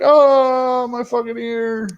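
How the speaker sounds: high-pitched hammed-up cry of pain; mock pained wail. Retelling the man shot in the ear, mock wail.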